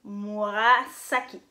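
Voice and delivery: Murasaki with a long, hissed S in "saki"; S non voisé. Shown as a demonstration. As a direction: say Murasaki slowly, holding a sharp s sound. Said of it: "vous prononcez S". (Murasaki avec un « s » sifflant, bien appuyé)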